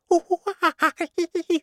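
high-pitched monkey hooting laugh; squealing storybook monkey call; monkey chatter. Acted. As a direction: imitate an excited monkey, high hoots. Monkey noise after introduction.

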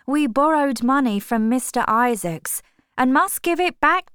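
a worried mother urging her daughter on; voiced mother, pleading. A narrator's reading.

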